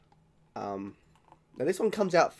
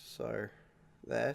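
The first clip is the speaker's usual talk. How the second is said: ordinary delivery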